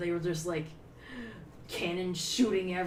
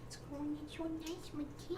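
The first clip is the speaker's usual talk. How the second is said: high, very soft pet voice; 9 semitones higher, 10 dB quieter than usual. Real, not acted. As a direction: murmur fondly to a cat, up high. Cooing baby talk to the cat.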